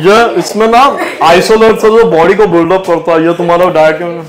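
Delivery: lightly mimicked gym guy selling supplements; hint of a know-it-all trainer's sales patter; gym trainer pitching protein. Gym trainer lecturing teacher on nutrition, lightly mimicked pitch. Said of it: "वो मुझे nutrition पढ़ा रहा था".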